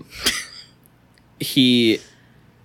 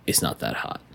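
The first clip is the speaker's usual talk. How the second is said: dry boss line; 7 semitones lower, 7 dB quieter than usual. Acted. Boss's dry reply.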